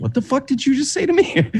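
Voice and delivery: very high-pitched mock outrage; offended disbelief. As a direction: squeak it out as an affronted American. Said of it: "they're like".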